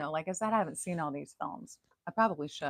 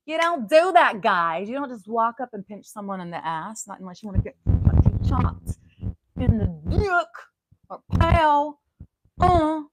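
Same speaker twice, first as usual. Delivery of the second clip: mock threat; 5 semitones higher, 12 dB louder than usual. Mock threat at groping men.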